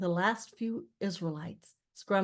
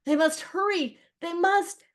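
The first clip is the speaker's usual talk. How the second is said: high, loud, urgent exclamation; urgent alarm; 10 semitones higher, 5 dB louder than usual. A narrator's reading. Aaron watching Israelites struggle from the chariots, urgent cry. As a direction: cry out in alarm, pitch jumping up.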